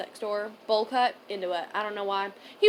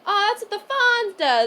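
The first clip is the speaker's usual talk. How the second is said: coworker quote; 10 semitones higher, 6 dB louder, slower than usual. Retold or imitated. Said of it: "she went".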